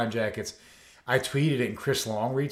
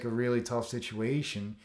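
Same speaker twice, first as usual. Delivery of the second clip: ordinary delivery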